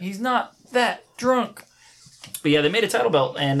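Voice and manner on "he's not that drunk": mocking imitation.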